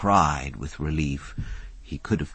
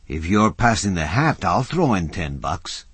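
plain reading voice

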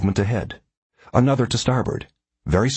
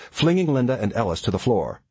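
ordinary delivery, nothing marked

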